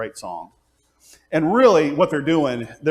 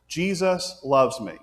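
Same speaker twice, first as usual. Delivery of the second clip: ordinary talk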